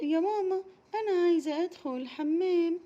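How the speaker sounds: put-on high pleading child voice; a small girl who needs the toilet now; urgent little girl. Raghad begging mama for the bathroom, high urgent child voice.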